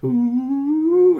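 high loud eerie moan; spooky wailing ooh; ghostly ooh. Acted. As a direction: wail like a ghost hiding in a tree. Ghostly noise in the tree.

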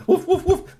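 high loud yappy woofs; robo dog barks. Acted. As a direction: bark like a tiny robot dog. Robo dogs barking.

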